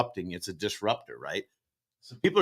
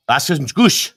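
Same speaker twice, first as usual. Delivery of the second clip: mock German; 3 semitones higher, 5 dB louder, slower than usual. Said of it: "my Northern European generalized".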